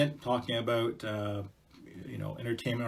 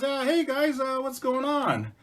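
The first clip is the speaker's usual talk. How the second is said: much higher, slow and drowsy; high mouse voice; 13 semitones higher, 3 dB louder, slower than usual. Acted. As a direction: amble in curious. Mouse wanders over.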